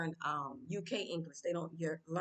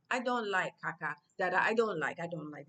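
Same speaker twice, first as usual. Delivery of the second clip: Tanzanian-accented English; 5 dB louder than usual. Shown as a demonstration. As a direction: talk English the local way. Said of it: "I'll just say".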